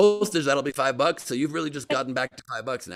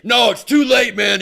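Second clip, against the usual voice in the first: much louder and higher mock yell; mock shout; 10 semitones higher, 12 dB louder than usual. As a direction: yell it down at him, playing tough. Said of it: "He's like".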